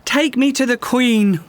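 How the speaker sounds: high, ringing call to set sail; rally the crew with a high shout; cried out. A narrator's reading. Rue calls to her crew to sail for the Queen, high ringing shout. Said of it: "Rue cried".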